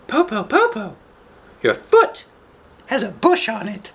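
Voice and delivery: put-on squeaky child calling through a door; high small voice, wary; high little girl voice. Eldest daughter asks who knocks, in a high child voice.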